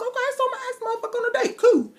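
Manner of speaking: very high, fast, gushing; eager rush. Retold or imitated. High fast agreement to ask Harley out. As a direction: blurt it out in a rush.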